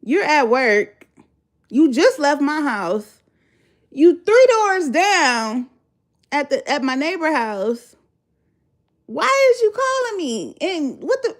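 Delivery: fed-up disbelief at him; exasperated. Retold or imitated. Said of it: "I'm like".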